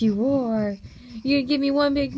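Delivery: ordinary talk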